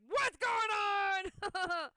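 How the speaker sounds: high, loud and drawn out; panicked shout. Retold or imitated. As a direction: stretch it out in alarm. Retold panic, jumping up.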